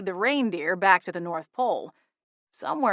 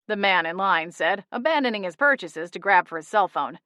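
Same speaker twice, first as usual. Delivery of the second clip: usual speaking voice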